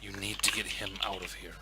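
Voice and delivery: hushed, low urging with pauses; very quiet whispering. Acted. Guard urges the rat to get him out, very quiet. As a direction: keep it secret, barely above a murmur. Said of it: "whispers to you very quietly".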